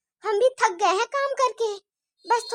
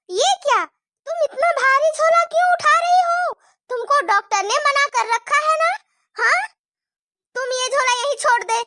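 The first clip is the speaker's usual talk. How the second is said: louder and higher, fussing alarm; alarmed scolding; 5 semitones higher, 5 dB louder than usual. Acted. Mother-in-law scolds pregnant bahu over the heavy bag, louder and higher. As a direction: stop her in alarm.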